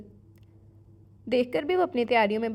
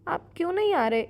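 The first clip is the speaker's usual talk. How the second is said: higher, pleading through tears; tearful plea; 7 semitones higher, 3 dB louder than usual. A narrator's reading. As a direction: beg him to come, still crying. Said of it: "ہنوس روتے ہوئے".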